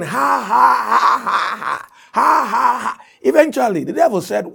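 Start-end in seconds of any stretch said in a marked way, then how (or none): 0.0-3.0 high, forced ha-ha laugh; put-on laughter. Man goes at the devil once more, high put-on laughter. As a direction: push out a high fake laugh. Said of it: "he was just putting it on".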